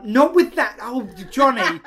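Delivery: loud mock outburst in an Irish accent; imitated Irish mum. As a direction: exclaim like an exasperated Irish mum. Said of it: "people sound more like their accent".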